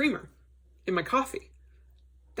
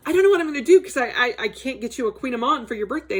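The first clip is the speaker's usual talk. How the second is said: flustered husband; 6 semitones higher, 4 dB louder, much faster than usual. Retold or imitated. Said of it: "he was like".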